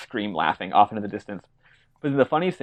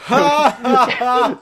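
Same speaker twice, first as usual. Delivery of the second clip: loud, high forced laugh; yelled fake laugh; 13 semitones higher, 9 dB louder than usual. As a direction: bellow out an imitated, forced laugh. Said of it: "he looks off and he yells".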